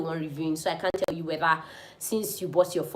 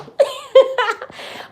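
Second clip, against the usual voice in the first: bright burst of laughter; laughing; 11 dB louder than usual. Real, not acted. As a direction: laugh it off, high. Laughs at own fast talk.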